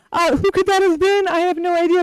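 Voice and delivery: high, feigned innocence; mock innocent. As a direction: play dumb about the caller. Mock innocent after impression.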